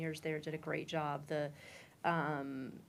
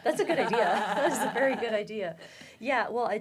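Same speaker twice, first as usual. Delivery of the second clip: loud, higher, laughing; 5 semitones higher, 10 dB louder, faster than usual. Real, not acted. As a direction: laugh out the words. Laughing at Halloween joke.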